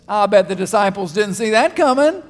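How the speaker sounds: usual speaking voice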